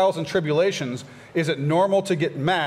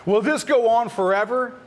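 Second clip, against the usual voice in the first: crying out to God, higher and louder; pleading psalmist; 6 semitones higher, 3 dB louder, slower than usual. Acted. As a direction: lift the lament up to heaven, urgent. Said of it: "you see the saints saying".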